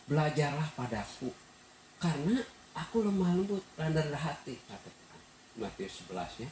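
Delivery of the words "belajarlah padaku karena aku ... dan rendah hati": higher, gentle voicing of Jesus; a hint of Jesus' gentle invitation; Jesus quoted. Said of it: "Tuhan bilang".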